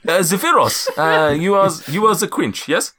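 Zephyros. Acted. Giant confirming who is who, high accented voice. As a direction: check the names in a high lilt. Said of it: "my accent's just all over the place".

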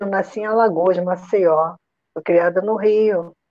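normal talking voice

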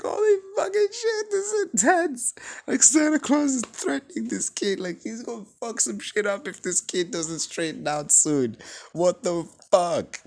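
high, loud, cracking with disbelief; can't believe what the movie did; shocked excited outburst. Real, not acted. Reviewer reacting to Santa threatening a kid, shocked. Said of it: "holy shit".

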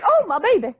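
put-on high, laughing cry over a hurt child; mock doting mother. Co-host imitating a mother after the slap, laughing high wail. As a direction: wail like a fussing mom. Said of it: "She's like".

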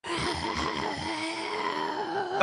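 guttural undead groan; zombie growl. Shown as a demonstration. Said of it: "It sounded like a zombie".

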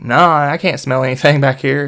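loud, emphatic mock innocence; covering denial. Covering for his friend in the car, loud denial. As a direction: deny it loudly to cover for someone.

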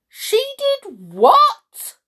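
high, loud, drawn-out disbelief; shocked cry. A narrator's reading. King hears of the smile, shocked cry. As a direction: shriek it in outraged astonishment. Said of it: "cried the king".

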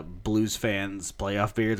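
normal talking voice

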